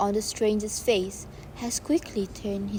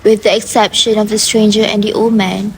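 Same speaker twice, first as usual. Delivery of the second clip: much louder, projected narration; 18 dB louder than usual. Acted. Narrator reads the crowd's rush to the house, loudly projected. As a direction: boom it out over the crowd. Narrator shouting stage directions.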